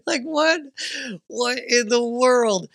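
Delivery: a baffled, laughing disbelief; stunned disbelief. Real, not acted. Dad reacting to son's remark, high loud disbelief.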